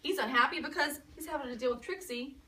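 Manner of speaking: usual speaking voice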